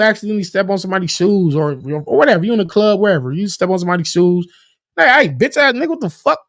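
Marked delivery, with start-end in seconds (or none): 4.9-6.4 higher-pitched mock outrage; acted confrontation. Club-goer to whoever stepped on his shoe, mock angry. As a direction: confront the clumsy stranger, play-acting.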